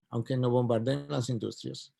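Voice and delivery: ordinary delivery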